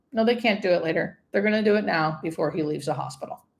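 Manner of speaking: normal talking voice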